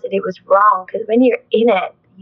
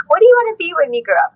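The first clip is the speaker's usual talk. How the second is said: parents asking; 10 semitones higher, 3 dB louder, much faster than usual. Retold or imitated. Parents asking child's dream.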